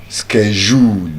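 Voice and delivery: "schedule" said "skejool", American style, slow. Shown as a demonstration. Teacher demonstrates the American pronunciation. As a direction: give the American "schedule" with a hard sk-. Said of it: "While the American".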